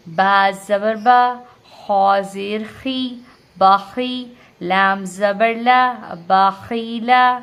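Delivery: slow, loud chanted syllables; spelling drill. Shown as a demonstration. Teacher spells a word for learners, slow loud recitation. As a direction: spell piece by piece, lifting onto the joined word. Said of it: "ہجے کی مشق بھی کریں گے".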